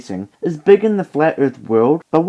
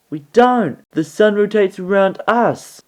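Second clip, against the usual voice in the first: mock flat earther; 6 semitones higher than usual. Flat earther's acted reply.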